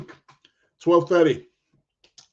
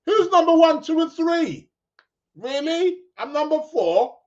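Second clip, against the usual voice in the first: high, incredulous questioning; mock indignation; 10 semitones higher than usual. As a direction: play up disbelief, pitch way up. Indignant at ranking only fourth.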